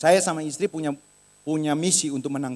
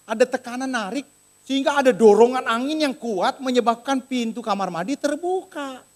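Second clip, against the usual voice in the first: normal talking voice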